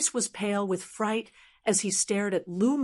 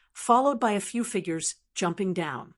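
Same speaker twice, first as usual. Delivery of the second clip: ordinary delivery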